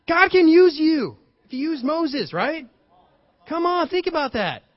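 high, loud, urging sermon voice; preaching at full force. Acted. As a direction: fire up the congregation.

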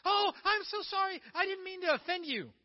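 high, loud, gushing apology; mock apologetic. Modern caricature of Jesus begs offended followers' pardon, exaggeratedly apologetic.

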